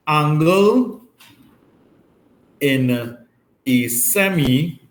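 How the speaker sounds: each word spaced with long pauses; slow dictation. Shown as a demonstration. Maths teacher dictating the reason for students to write down, slow. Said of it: "we must put the reason".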